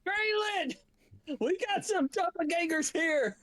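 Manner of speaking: high-pitched call to a companion. Acted. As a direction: call out for backup, pitched well up. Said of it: "Well, he yelled".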